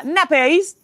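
put-on playful English-accent pronunciation of the word. Podcaster saying nappies to her co-host, playful English accent. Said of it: "I can't do the accent".

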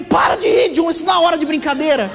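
mother scolds John for laughing, high shouting; scolding mother. Retold or imitated. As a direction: snap at him to stop laughing.

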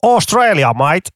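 playful fake Australian accent; mock Australian accent. Joking about Australia as the country.

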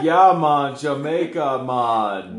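hammed-up loud Jamaican accent. Performing on request for friends, Jamaican accent. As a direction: call it out in a broad Jamaican accent. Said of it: "give me a Jamaican accent".

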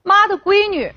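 tearful embrace. A narrator's reading. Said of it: "眼泪啊一下子就下来了".

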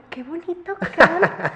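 high, loud mock gush with a laugh; laughing fake praise; gushing teacher. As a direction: imitate a delighted teacher. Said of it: "se quedó como de".

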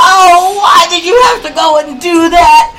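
high, loud wailing witch impression, mocking; hammed-up melting witch. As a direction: ham up the dying witch's wail. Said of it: "She's like".

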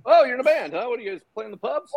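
high, chirpy pretend ignorance; clueless small talk. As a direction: play someone oblivious to the star. Imagined clueless fan meeting a star.

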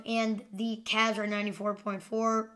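ordinary talk, nothing marked

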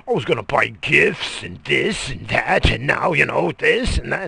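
high, loud whiny imitation of the wife; mocking wife. Husband mimicking wife's gift excuses, loud whiny mock voice. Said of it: "She's like".

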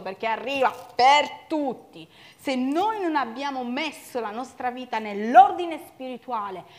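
loud, driving sermon voice; fiery preaching. Acted. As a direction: preach it out at full force. Preaching at full force. (predica appassionata ad alta voce)

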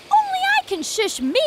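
high, bossy and affronted; indignant. Acted. Mock indignant protest.

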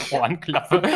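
laughing through the word; giggling out the word. Real, not acted. Repeats the silly word laughing.

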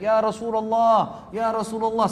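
mock calling out from afar, stretching the words; imitated far-off shout. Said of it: "dari jauh teriak". (menirukan teriakan panggilan dari jauh)

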